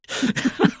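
high airy laugh; real laugh. Real, not acted. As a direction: burst of disbelieving laughter. Laughs at teacher.

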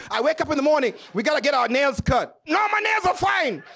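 ordinary talk, nothing marked

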